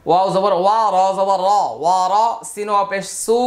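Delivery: ordinary delivery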